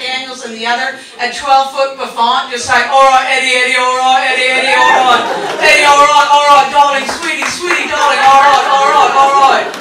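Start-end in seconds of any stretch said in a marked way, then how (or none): 2.9-9.7 boozy British sitcom character. Acted. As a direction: play a hammed-up boozy British lush.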